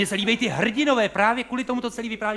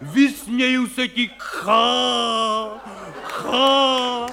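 hammed-up stage bellowing; 4 semitones higher, much slower than usual. Stage hero yelling at wind and death, mock-heroic roar. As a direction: ham it up like a tragic hero defying death. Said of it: "křičej tam, prostě řvou".

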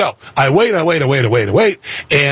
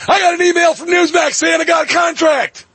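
joyful shout. Retold or imitated. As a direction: shout out the good news. Big news, shouted with joy.

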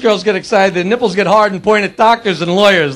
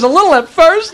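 sheepish son; 5 semitones higher, 3 dB louder than usual. Acted. Son's punchline answer to father.

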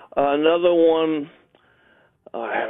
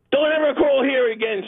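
high, mildly yelled telling-off; mock yell; 11 semitones higher than usual. Lawyer yelling at a caller over a bill, mock yell. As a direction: mimic a high-pitched angry yell without real volume. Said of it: "she yells at me".

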